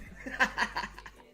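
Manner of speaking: high, breathy burst of laughter. Real, not acted. Streamer cracking up at his own catchphrase: laughing. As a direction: crack up at your own joke.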